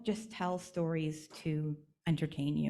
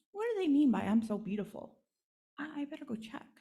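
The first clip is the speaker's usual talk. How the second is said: higher, puzzled and curious; wondering doll; 8 semitones higher than usual. Acted. Doll puzzling over the children's words, higher and curious. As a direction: puzzle it out to yourself.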